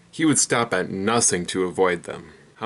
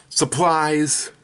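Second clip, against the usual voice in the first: janitor shout; 6 semitones higher, 4 dB louder than usual. Acted. Janitor jumps out of closet.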